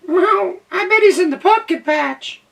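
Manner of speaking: very high, loud meowing cat voice; scoffing cat. Acted. Cat boasting to the squirrel, high and loud.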